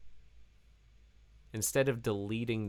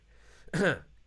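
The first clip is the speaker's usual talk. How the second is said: clears throat; 8 semitones higher, 8 dB louder than usual. Real, not acted. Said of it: "I think I'm losing my voice here".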